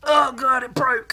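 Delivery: real startled shout when something snaps; real dismay. Real, not acted. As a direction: yelp as something breaks. Something breaks suddenly.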